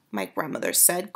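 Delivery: ordinary delivery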